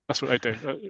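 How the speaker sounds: normal talking voice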